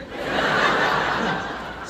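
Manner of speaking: amused chuckle; breathy laugh. Real, not acted. Laughing at sister's answer.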